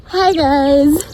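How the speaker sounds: bright loud hello; loud greeting. Real, not acted. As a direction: call out hi. Greeting people outside.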